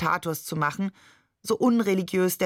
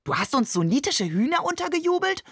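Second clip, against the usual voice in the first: much higher, incredulous mock outrage; outraged; 10 semitones higher than usual. A narrator's reading. Shakir accusing Babe of smuggled chickens, mock outraged. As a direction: shriek it in mock disbelief.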